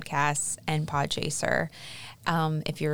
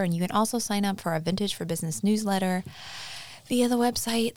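ordinary talk, nothing marked

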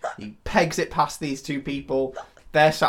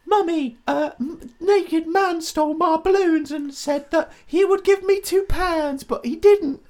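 put-on high, whiny Cockney kid, slow; a Cockney little boy telling tales to his mum; Cockney child voice to his mummy; annoying Cockney child; slower than usual. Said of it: "annoying Cockney child accent".

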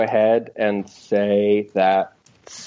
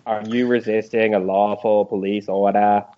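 usual speaking voice

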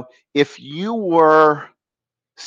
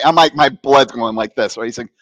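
ordinary talk

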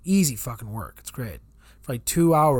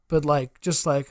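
ordinary delivery

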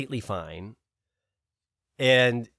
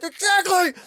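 high, loud imitation of enthusiastic agreement; excited agreement; 8 dB louder, slower than usual. Robert cheering her rant on, high and loud. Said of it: "And Robert's like".

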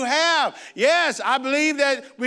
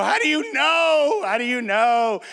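put-on anxious child's cry; 3 semitones higher, 3 dB louder, slower than usual. Boy asks his dad how he knows he is saved, anxious cry. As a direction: press dad for proof. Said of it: "I go".

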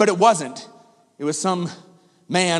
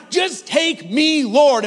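pleading; 7 semitones higher, 3 dB louder, much slower than usual. Retold or imitated. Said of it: "I doubled down on the bargain".

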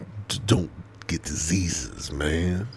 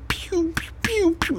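high laser pew sounds; pew pew popping noises. Retold or imitated. Joking about popping herpes pods, high laser pew sounds. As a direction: zap it like Star Wars blasters.

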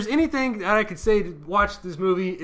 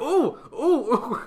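high giddy ooh with a laugh; excited fanboy squeal; 8 semitones higher, 4 dB louder, much slower than usual. Retold or imitated. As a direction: squeal like a thrilled kid. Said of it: "I was like".

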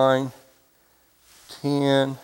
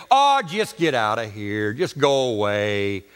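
low, weary brush-off; acted grumbling dismissal, pitched low; dismissive disciples; 9 semitones lower than usual. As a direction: wave her off in a dropped pitch. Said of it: "all the disciples chime in and say".

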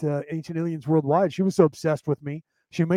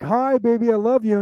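sweet morning greeting; 5 semitones higher, 4 dB louder than usual. Retold or imitated. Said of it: "I would be like".

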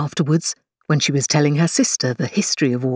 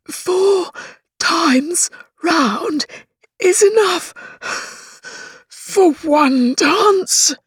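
breathless, high and slow, a lightly acted pant; 11 semitones higher, much slower than usual. A narrator's reading. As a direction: gasp it out like a fat dancer who is winded. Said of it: "Tweedledum panted out".

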